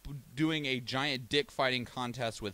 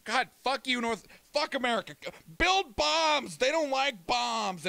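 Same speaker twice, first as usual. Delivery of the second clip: higher, hammed-up defiant taunt; mock North Korea; 10 semitones higher, 3 dB louder than usual. Streamer voicing North Korea defying America, higher mock taunt. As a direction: play a bratty country shouting back.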